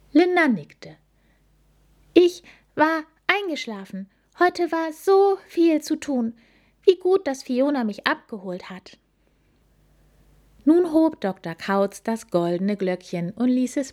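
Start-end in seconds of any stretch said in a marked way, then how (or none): 2.1-9.0 lightly high, gentle snail voice; a hint of a sleepy little snail; snail postwoman voice. (leicht verstellte Schneckenstimme)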